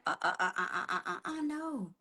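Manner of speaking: soft, stuttering mock sobs; imitated crying. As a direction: act out a hushed, hiccuping cry. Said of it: "she was crying".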